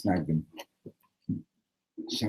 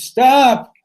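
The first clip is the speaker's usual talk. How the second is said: high, loud cry of protest; scream; 12 dB louder than usual. Acted. Newcomer interrupting the blessing, screaming. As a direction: cut them off with a scream. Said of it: "and he screams".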